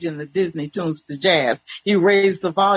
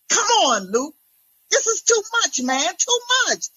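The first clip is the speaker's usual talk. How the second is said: pleading; 13 semitones higher than usual. A narrator's reading. Pleading wife in argument.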